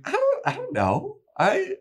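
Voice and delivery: very high, squeaky stammer; flustered Willow. Retold or imitated. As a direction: fumble for words, caught out.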